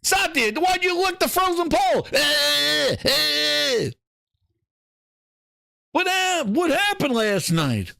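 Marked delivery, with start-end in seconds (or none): 2.1-3.9 tongue-stuck stutter. Acted. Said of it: "fix his fucking stuttering".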